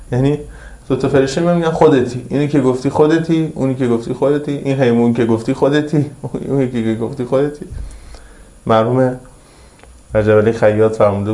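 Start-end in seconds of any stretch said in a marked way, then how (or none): none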